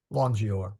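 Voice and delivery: "Longior" with a soft G, LON-jee-or; "Longior" with G as in "gem". Shown as a demonstration. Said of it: "Soft G".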